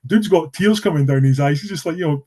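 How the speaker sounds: ordinary delivery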